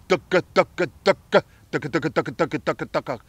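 rapid "ducka" chatter; fast feeding call. Shown as a demonstration. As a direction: rattle "ducka" off quickly. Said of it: "If you wanna make it a little faster".

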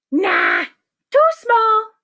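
high, loud rejection; dismissive doctor. Acted. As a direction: wave it off in a cartoonish voice. Doctor rejects longer needle.

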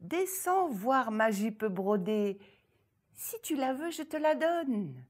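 mock-sweet ogress, pitched high; ogress tempting. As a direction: tempt her with the skirt. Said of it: "L'horrible ogresse continua".

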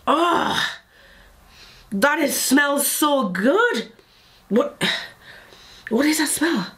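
high, slow, thrilled exclamation; delighted gasp. Real, not acted. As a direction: swoon over the scent. Thrilled by the smell.

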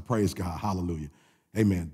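usual speaking voice

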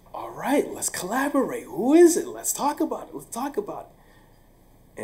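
high, eager push to team up; eager excitement. Retold or imitated. Teacher eager to team up with the office, high excited. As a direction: lean in, thrilled to collaborate.

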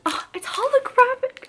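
high, delighted gasp of wonder; thrilled. Real, not acted. Vlogger discovering a holographic keychain, high and thrilled. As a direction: marvel at the shiny toy.

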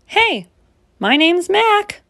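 high, slow goofy monster greeting; lightly voiced friendly monster; boy monster voice. A narrator's reading. New monster introduces himself.

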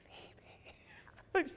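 soft, breathy whisper of a name; sheepish whisper. Retold or imitated. Answering Julia's question, a sheepish whisper. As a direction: barely breathe the name out, embarrassed.

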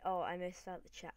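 normal talking voice

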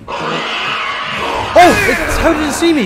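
frantic high panicked yelling; panicking gamer. Real, not acted. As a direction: scream in real panic. Zombie spots him, screaming.

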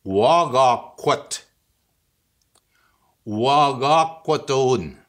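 Wagakwat and Wagakwatun said very slowly, syllable by syllable; slow teaching demo. Shown as a demonstration. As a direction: spell Wagakwatun out slowly for learners. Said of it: "An axe".

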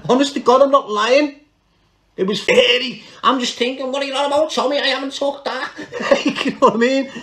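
high, amused voice breaking into laughs; laughing. Real, not acted. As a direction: tell it through real laughter. Laughing at his own story.

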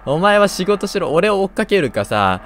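loud, high teasing scold; mock scolding. Real, not acted. Telling the lunch-lady granny to go to work, loudly scolding. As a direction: berate her half-joking.

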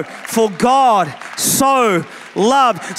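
each word drawn out slowly; slow, emphatic. Shown as a demonstration. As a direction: stretch every word, savor it. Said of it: "you thought he was angry? No".

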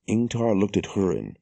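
ordinary delivery, nothing marked

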